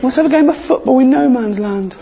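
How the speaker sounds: cheery soldier. Acted. Soldier proposing Christmas truce.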